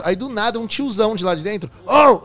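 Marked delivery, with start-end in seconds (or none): 1.9-2.2 sudden loud shout from the dark. Retold or imitated. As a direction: yell out to startle. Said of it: "Na hora que o cara gritou".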